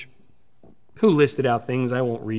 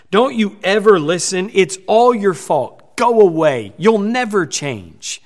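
louder and higher, a rising tirade of insults; angry scolding; 4 semitones higher, 6 dB louder than usual. Acted. Parent yelling cutting words at a child, angry and loud. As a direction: berate them like a furious parent. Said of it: "here's some things that we shouldn't say".

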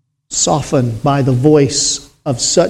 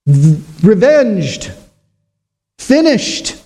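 voice of the blood; 3 semitones higher, slower than usual. Acted. Preacher voicing the blood rising to heaven, exultant. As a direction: ring out each word like a verdict. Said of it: "every drop of blood lifts its voice".

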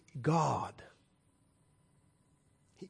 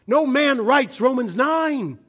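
higher, louder and slow, each word pressed; emphatic proclamation; 8 semitones higher, 5 dB louder, slower than usual. Real, not acted. Preacher insisting to the congregation, slow emphatic proclamation. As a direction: drive the claim home.

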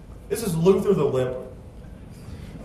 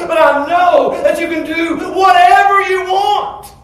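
loud, high, driving sermon voice; fiery preaching; 12 semitones higher, 9 dB louder than usual. Real, not acted. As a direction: preach it with rising fire. Preaching at full force.

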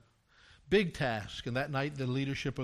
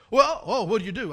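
higher and louder, caught off guard; surprised question; 7 semitones higher, 11 dB louder than usual. Retold or imitated. Church member startled by the pastor's request, surprised and loud. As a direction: blurt it out surprised.

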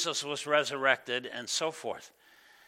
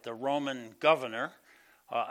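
usual speaking voice

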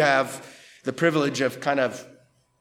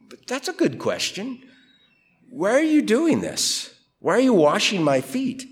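high-pitched incredulous questions; acted-out puzzlement; puzzled questioning; 6 semitones higher than usual. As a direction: ask it as a baffled challenge, pitch raised. Voicing a puzzled challenge to Jesus.

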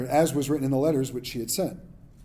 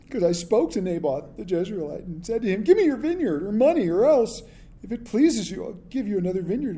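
whiny Ahab; 5 semitones higher than usual. Shown as a demonstration. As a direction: whine it like a sulking king. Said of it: "I have to say it in a whiny voice".

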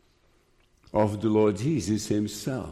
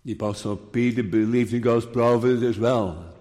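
ordinary talk

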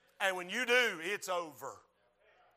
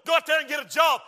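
raised, forceful acted taunt; loud scornful order; 8 semitones higher, 11 dB louder, faster than usual. Devil telling the preacher to quit, loud taunt. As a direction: order them out as the devil would.

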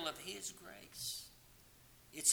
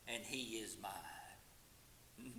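slow, unvoiced close to the hymn line; whispered ending; 5 semitones lower, 17 dB quieter, much slower than usual. Real, not acted. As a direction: breathe the last words out slowly.

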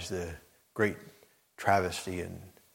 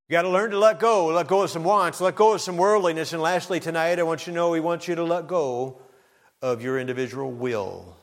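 full-force preaching; 8 semitones higher, 6 dB louder than usual. Acted. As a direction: preach it up to the climax.

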